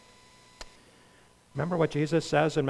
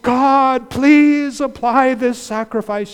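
much higher, louder and slow; pleading prayer; 8 semitones higher, 8 dB louder, slower than usual. Acted. Preacher voicing the sinner begging God to apply the sacrifice.

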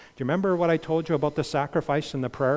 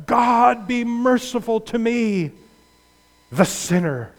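higher, louder cry to God; desperate prayer; 7 semitones higher, 4 dB louder than usual. Acted. Preacher voicing the tax collector crying to God. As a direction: cry it out as a broken sinner.

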